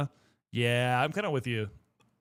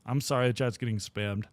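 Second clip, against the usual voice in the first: usual speaking voice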